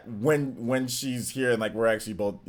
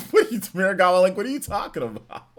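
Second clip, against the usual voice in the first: laughing protest; 6 semitones higher, 4 dB louder than usual. Real, not acted.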